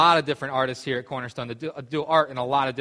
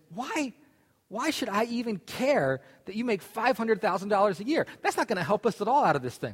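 much higher, frantic voice; exasperated panic; 7 semitones higher than usual. Retold or imitated. Stranded man frantically questions his calm friend, much higher, exasperated. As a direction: throw up your hands in panic.

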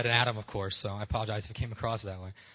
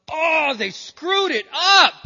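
much higher and louder, slow hammed-up shout; mock God shout; 13 dB louder, much slower than usual. God shouting that they ruined Eden, hammed-up. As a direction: yell it like a comically exasperated God. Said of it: "He goes".

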